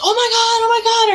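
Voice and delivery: frantic relief rushing out into the rain; panicked oh my God. Retold or imitated. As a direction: shriek it in panic. Runs out terrified to grandparents.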